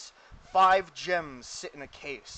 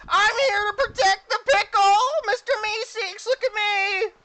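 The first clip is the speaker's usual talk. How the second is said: very high, blaring creature voice; Mister Meeseeks; 11 dB louder than usual. Acted. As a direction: squeal it loudly as an eager helper. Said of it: "the Meeseeks walks in and stands in front of him".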